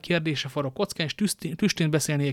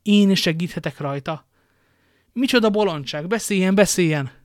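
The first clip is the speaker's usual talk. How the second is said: louder, higher, impatient urging; agitated exclamation; 5 semitones higher, 6 dB louder than usual. Acted. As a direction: urge the messenger to speak up. Buckingham urgently demands the news.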